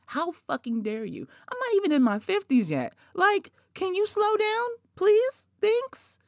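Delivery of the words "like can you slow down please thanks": high, sarcastic can you slow down; mock politeness to the marketers; sarcastic plea. Telling AARP marketers to slow down, high sarcasm.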